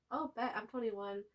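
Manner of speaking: ordinary delivery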